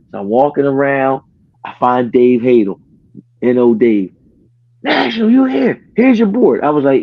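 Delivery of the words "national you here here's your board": high, urgent mock call-out; raised-pitch retold holler. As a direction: imitate someone hailing a player across a party. Retold coach calling him over.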